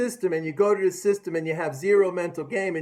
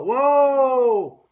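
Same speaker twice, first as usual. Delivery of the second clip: excited whoa; 5 semitones higher, 6 dB louder than usual. Real, not acted. Cheering the market going up.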